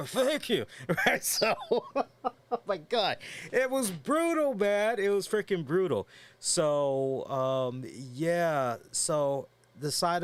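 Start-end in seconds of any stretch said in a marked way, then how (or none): 0.1-0.7 high, garbled, numb-mouthed mimicry; hammed-up dentist-numbed mumble; imitating numb lips. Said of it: "when I first began trying to talk". 0.9-6.1 high-pitched, laughing exclamation; cracking up while talking. Real, not acted. Laughing at own numb-mouth story.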